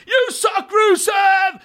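high, loud wrestling-crowd yell in British English; British heckling shout. Retold or imitated. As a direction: bellow it like a rowdy British fan. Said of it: "De roper på britisk".

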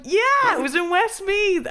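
hammed-up American enthusiasm; mock eager American. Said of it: "She's like".

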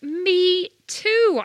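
high, drawn-out, eager exclamation; excited me-too. Retold or imitated. Retelling her reaction to the Rolling Stones story, excited. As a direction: cry out keenly, stretching it high.

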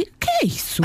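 put-on a child's very high, demanding question; suspicious child voice. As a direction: pounce like a nosy kid. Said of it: "Começa assim a olhar para mim". (criança desconfiada)

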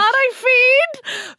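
slow drawn-out Cork greeting; Cork accent. Retold or imitated. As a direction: stretch it out in a thick Cork accent. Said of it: "That's real Cork".

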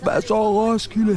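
high, slow stage call to the crowd; mock rock-star shout. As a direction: call out to the festival crowd like a frontman. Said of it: "har lyst til at stå og råbe".

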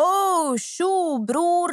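high, drawn-out mock-surprised greeting to a bro; startled greeting. Startled stranger caught in the bushes.

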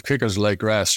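ordinary delivery

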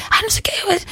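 a sly whisper mocking northern gossip. Mock gossip whispered about a Lisbon snob. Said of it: "o povo acha lá".

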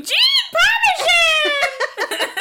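mock scream in cartoon Italian style; screamed catchphrase. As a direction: holler it out, hammed up. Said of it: "I scream".

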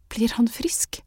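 breathy whispered question; barely a whisper, afraid to ask. A narrator's reading. Mother asks the healer, whispered. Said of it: "hvisket moren".

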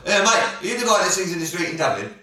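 lightly voiced London geezer asking a question; a touch of EastEnders London; London accent. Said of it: "his London accents".

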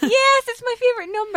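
high, bright, loud teasing; excited. Real, not acted. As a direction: squeal it like a joke. Playful high reply.

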